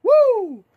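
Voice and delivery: high loud whoop; whooping cheer. Real, not acted. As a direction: cheer like a fan in the theater. Cheering Killmonger's return.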